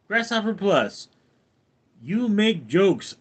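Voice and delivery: ordinary talk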